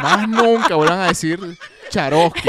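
loud mock prohibition; scolding character. Host imitating the viral character to wrap up, mock scolding. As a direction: forbid the word with comic outrage.

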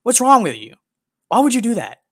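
high, loud, incredulous mock outrage; mock outraged retort. Imagined reply to a stranger who assumes his language, loud mock outrage. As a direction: blast a baffled stranger with mock disbelief.

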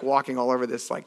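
ordinary talk

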